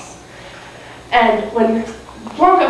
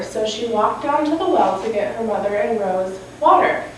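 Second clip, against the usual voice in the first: ordinary delivery, nothing marked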